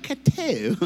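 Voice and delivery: high, loud dainty mock sneeze. Mimicking a woman's pretty sneeze, dainty and high. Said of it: "women sneeze pretty".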